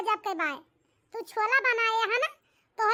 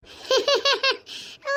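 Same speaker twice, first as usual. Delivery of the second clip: amused laugh at the joke; hearty laugh. Acted. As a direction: burst out laughing at the teasing. Sister laughs at oil advice.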